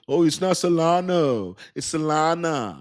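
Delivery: high, drawn-out mocking correction; mimicking nitpicky correctors. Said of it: "I know people like".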